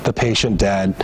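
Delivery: ordinary talk, nothing marked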